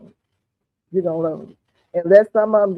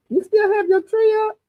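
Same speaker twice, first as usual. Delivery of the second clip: lightly voiced high mock question, much higher pitch; incredulous neighbor; 14 semitones higher than usual. Mocking people who question her Christmas tree, high incredulous tone. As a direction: play the doubting neighbor, voice leaping up. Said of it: "I have people who say".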